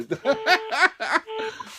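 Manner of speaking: long high laugh; laughter. Real, not acted. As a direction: crack up after the hang-up. Caller has hung up. (long rire aigu)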